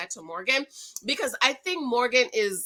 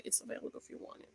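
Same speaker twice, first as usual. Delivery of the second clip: whispered aside; 21 dB quieter, much faster than usual. Real, not acted. Aside about her shirt.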